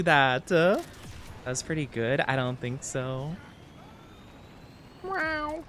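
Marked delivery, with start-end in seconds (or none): 5.0-5.6 a higher, softer cat-like meow; realistic meow. Shown as a demonstration. Streamer trying a more genuine cat meow. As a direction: sound like an actual cat. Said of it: "more an actual meow".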